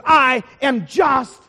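loud, drawn-out indignant reply; offended, emphatic retort. Acted. Plane passenger asked again about reading it, loudly indignant. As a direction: refuse loudly, one slow word at a time. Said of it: "He goes".

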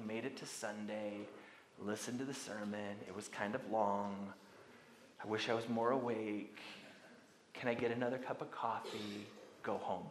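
low, drowsy mumble of a bored pew-sitter; sleepy churchgoer. Acted. Preacher mimics a dull churchgoer, low and sleepy.

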